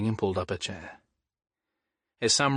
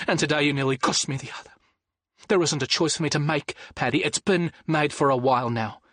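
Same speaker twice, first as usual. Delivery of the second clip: a hint of a resolute mother, slightly raised; steady and determined, standing her ground; Norma's firm plea; 4 semitones higher than usual. Wife telling husband Paddy she's decided, resolute.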